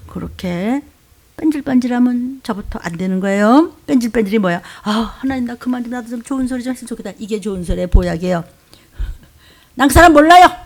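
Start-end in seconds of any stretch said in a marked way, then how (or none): 4.8-7.1 soft, hurried mock lament; weary complaint. Acts own weary complaint to God. 9.7-10.5 high, loud acted denial; forceful playacted disavowal; emphatic denial. Gives Peter's denial to be repeated.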